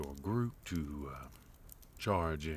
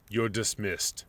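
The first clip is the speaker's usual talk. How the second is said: raised, higher, firm dismissal; curt dismissal; 5 semitones higher, 8 dB louder than usual. Acted. Host dismissing a subordinate with a firm, raised command. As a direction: send the servant away with a sharp command.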